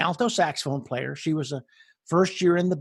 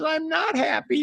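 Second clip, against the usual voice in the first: high, whiny imitation of a weeping girl; mock crying student; 10 semitones higher than usual. Crying student admits regret to band director, mock high-pitched weepy voice. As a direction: wail it like a miserable student. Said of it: "And she starts crying".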